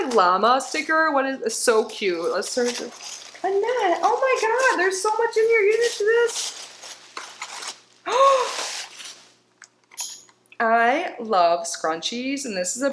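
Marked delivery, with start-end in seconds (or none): 3.4-6.6 excited gush. Real, not acted. Delighted unboxing discovery. 8.0-9.1 sharp high gasp of delight; a thrilled, pitched-up gasp. Real, not acted. Finds a gift in the box.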